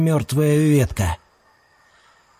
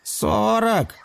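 lazy drawl; 8 semitones higher, 3 dB quieter than usual. A narrator's reading. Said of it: "лениво процедил Кольцехвост".

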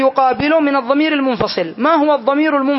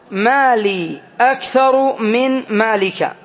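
slow dictation; much slower than usual. Shown as a demonstration. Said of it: "teacher reads example slowly".

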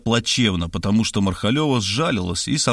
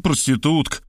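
plain reading voice

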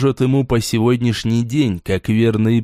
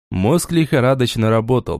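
ordinary delivery, nothing marked

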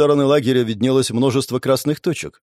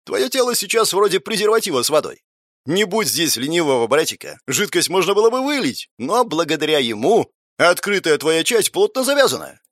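put-on mischievous teen voice; 3 semitones higher than usual. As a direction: tease like a cheeky teenager.

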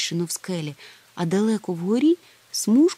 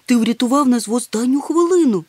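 high, loud, joyful gratitude; delighted; 7 semitones higher, 7 dB louder than usual. A narrator's reading. Moomintroll rescued at the last minute, delighted. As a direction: gush with relief. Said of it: "тішився Мумітроль".

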